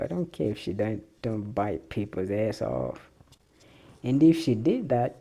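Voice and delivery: usual speaking voice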